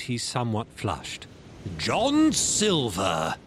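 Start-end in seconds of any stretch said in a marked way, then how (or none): 1.8-3.3 squire addressing Silver. Acted. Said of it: "he said".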